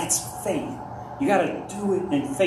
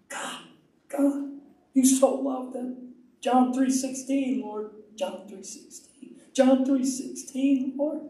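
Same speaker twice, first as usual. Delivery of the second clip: high, slow, pleading cry to God; re-enacted weeping intercession; tearful prayer; 8 semitones higher, slower than usual. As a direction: pray it high and drawn out, dropping to a breath. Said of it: "And I start to cry".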